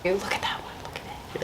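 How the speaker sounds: whispering. Real, not acted. Hushed over a big millipede.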